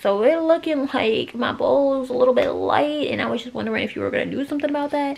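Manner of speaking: high, cheeky pet voice; dog's voice. Retold or imitated. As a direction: voice the dog making a pointed request. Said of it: "She's like".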